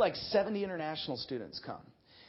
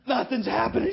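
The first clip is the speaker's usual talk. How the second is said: ordinary talk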